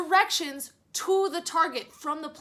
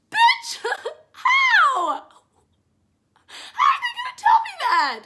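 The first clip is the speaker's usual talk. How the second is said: much higher, louder incredulous yell through laughter; laughing outraged shout; 8 dB louder than usual. Real, not acted. Venting to viewers about the scammers, a laughing shout. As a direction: shriek in disbelief, laughing at how absurd it was.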